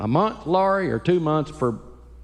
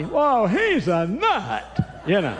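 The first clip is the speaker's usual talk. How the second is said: higher, slow Barney Fife voice; Barney Fife impression; 6 semitones higher, slower than usual. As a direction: stretch it out, high and nervous. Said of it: "And old Barney".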